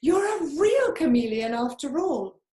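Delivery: high, loud, thrilled tree frog; excited call. Acted. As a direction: cheer him on, delighted. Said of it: "excited call".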